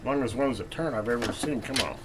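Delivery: ordinary delivery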